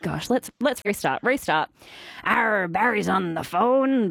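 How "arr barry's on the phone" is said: slow, drawn-out hammed-up pirate accent; terrible pirate accent. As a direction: put on a bad pirate accent, stretching the arr. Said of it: "That was a terrible, terrible pirate accent".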